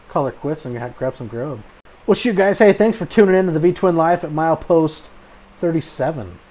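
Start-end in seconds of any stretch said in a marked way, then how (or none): 2.1-6.4 podcast outro. Acted. Host reads podcast outro.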